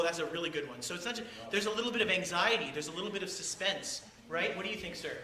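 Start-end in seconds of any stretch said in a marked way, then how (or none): none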